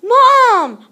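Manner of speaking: high, loud cry of alarm; scream for mom. A narrator's reading. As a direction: shout for Mom in panic. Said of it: "I screamed".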